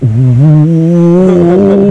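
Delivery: high teasing "Ooh"; mock scolding ooh. Kid teasing a friend for swearing, high mock ooh. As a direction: tease like a kid telling on a friend. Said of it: "he was like".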